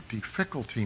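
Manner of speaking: "difficulty" stressed on its second syllable, di-FIC-ulty; misplaced stress. Retold or imitated. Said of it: "the emphasis on the wrong syllable".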